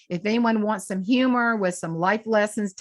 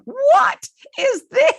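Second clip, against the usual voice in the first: much higher, louder, slow mock disbelief; mock astonishment; 9 dB louder, much slower than usual. Tourist hearing a strong Northern accent, hammed-up astonishment. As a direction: stretch it out in high comic shock.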